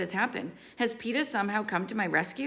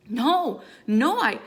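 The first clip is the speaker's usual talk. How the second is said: high, loud frightened stammer; panicked denial; 6 semitones higher, 7 dB louder, slower than usual. A narrator's reading. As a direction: protest loudly and high, breaking off. Cornered victim denies, breaks off.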